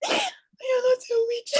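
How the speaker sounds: high, slow, tearful words; crying. Real, not acted. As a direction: pitch shooting up into sobs. Breaks down reassuring herself.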